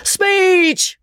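very high-pitched, drawn-out call; shout. A narrator's reading. Man calls on Magnolia, shouting. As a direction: holler for a speech. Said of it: "shouted the man".

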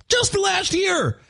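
high, incredulous exclamation; shocked outburst. Real, not acted. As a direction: say it in disbelief. Shocked at railroad deaths.

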